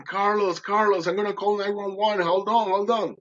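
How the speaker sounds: urgent retold shout; shouting from afar. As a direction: call down from the hill to a drowning friend. Friend shouting from hilltop.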